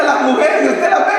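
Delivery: ordinary talk, nothing marked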